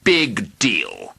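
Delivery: a touch of rude dismissal. A narrator's reading. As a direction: brush him off. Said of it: "interrupted the dragon rudely".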